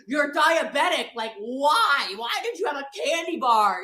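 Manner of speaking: loud, slow scolding; exasperated yelling. Retold or imitated. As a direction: berate her for the candy bar. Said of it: "He's like".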